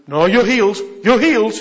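loud, pushy insisting; bolshy insistence. Retold or imitated. Pushy minister insisting on healing, loud and bolshy. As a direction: push it on them. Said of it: "You don't have to be bolchy".

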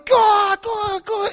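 high, loud, cheering; crowd urging on. Retold or imitated. Roadside crowd pushing the runner onto the track, cheering. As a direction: egg him on like a crowd at the track. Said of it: "they all start going".

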